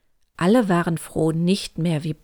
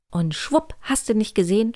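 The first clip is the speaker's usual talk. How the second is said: usual speaking voice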